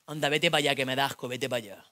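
low dismissive sneer; disgusted boyfriend. Acted. Boyfriend pushing girlfriend off, low. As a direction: push her away in disgust.

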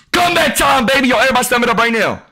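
hype. Real, not acted. Hyping his comeback.

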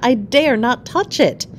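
high, awed exclamation; crying out. A narrator's reading. Wife not daring to touch the magical flower, crying out. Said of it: "cried Georgina".

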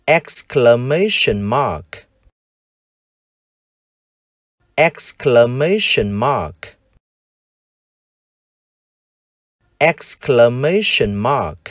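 exclamation mark, stress on the third syllable, slow; English exclamation mark. Shown as a demonstration. Teacher drilling exclamation mark aloud. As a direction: spell out exclamation mark slowly. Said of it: "chúng ta đọc là".

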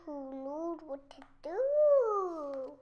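drawn-out question. Real, not acted. Child draws out the line.

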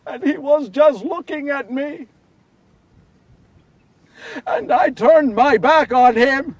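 loud, high-pitched torment; anguished confession. Acted. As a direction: relive the betrayal in anguish. Anguished memory of abandoning Jesus.

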